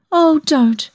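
high, wretched protest; miserable. A narrator's reading. Young woman refusing her lover's comfort, high and miserable. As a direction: beg it in a high, unhappy voice. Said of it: "I said miserably".

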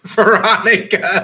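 high drawn-out laughing call. Real, not acted. Calls for help with jar.

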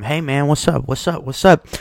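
a hint of a moocher angling to come in; pestering buddy. [name] imitating the guy at his door, a light pushy greeting. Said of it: "he's knocking on my door every week".

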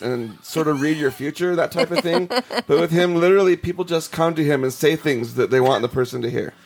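normal talking voice

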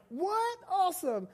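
high, excited What?, then Awesome; mock excited teen. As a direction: play the thrilled kid getting the keys. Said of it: "And you're like".

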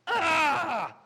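loud, high wail of frustration; exasperated prayer cry. Retold or imitated. Preacher re-enacting his own honest prayer, exasperated cry. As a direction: cry out a wordless, desperate prayer. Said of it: "I've actually prayed".

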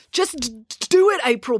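high, stammering plea; nervous stuttered pleading; pleaded. A narrator's reading. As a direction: beg her, stuttering on the d. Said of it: "pleaded Joe".

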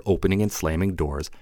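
plain reading voice

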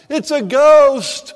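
high, loud, slow panicked shout; terrified cry. A narrator's reading. Disciples seeing a figure on the sea, terrified cry. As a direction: shout it out in terror. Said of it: "they cried out in fear".

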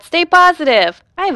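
bright high push to stay positive; upbeat coaxing to a gloomy friend; perky encouragement. Acted. Cheering him up, high loud.

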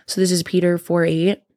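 ordinary talk, nothing marked

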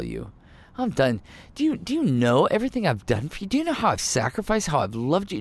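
acted exasperated rant, pitch leaping up; fed-up Jesus. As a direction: rail at people who have betrayed you. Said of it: "is this what Jesus is saying here?".

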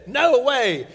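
loud flat no, tossed back at dad; defiant refusal. Retold or imitated. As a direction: refuse him loudly, like a stubborn teen. Son refusing father, acted.

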